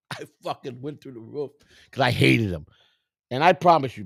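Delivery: ordinary talk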